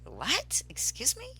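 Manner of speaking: sharp rising disbelief, high on excuse me; a startled, incredulous retort; retold disbelief. Told to jump off cliff.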